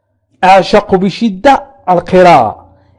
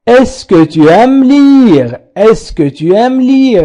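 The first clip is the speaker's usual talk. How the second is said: very slow, syllable by syllable; slow teacher demo; slow model sentence; 5 semitones higher, much slower than usual. Shown as a demonstration. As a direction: spell it out slowly for learners. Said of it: "لاحظوا معي".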